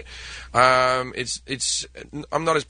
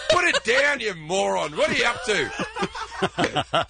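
high laughing shout of a cop; laughing mock cop yell; 7 semitones higher, slower than usual. Comedian voicing Australian cops, laughing through the shout. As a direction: yell the order while cracking up.

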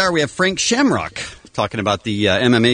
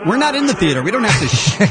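ordinary talk, nothing marked

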